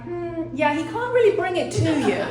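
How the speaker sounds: deadpan joke breaking into a laugh; telling mom the dead dog can't be brought, laughing; wry laughing. Retold or imitated. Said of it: "And I'm like".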